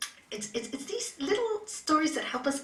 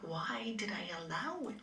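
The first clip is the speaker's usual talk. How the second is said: whispered question; 3 semitones lower, 10 dB quieter than usual. Shown as a demonstration.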